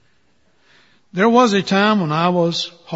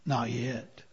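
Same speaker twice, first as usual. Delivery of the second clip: much softer, low and calm; quietly, with a smile; gentle; 5 semitones lower, 14 dB quieter than usual. Acted. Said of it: "just smiled and said".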